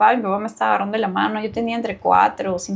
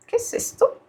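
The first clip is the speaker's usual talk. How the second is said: high, breathy wondering; a hushed startled question to herself; puzzled whisper; 14 semitones higher, 4 dB quieter than usual. Retold or imitated. Puzzled by a strange contraction. (susurro agudo de extrañeza)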